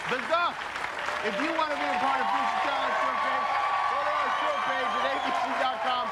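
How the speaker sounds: high, projected TV host voice; talk-show announcer. Acted. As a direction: wrap up the act over applause.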